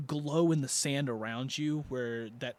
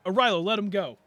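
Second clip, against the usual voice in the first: lightly acted urgent command, raised and louder; Yorn; 5 semitones higher, 7 dB louder, faster than usual. Game master as Yorn telling a companion to release someone, urgent call. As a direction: call out a quick order in character. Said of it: "Yorn then goes".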